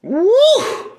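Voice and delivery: high joyful woo; excited whoop. Real, not acted. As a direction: let out a happy whoop.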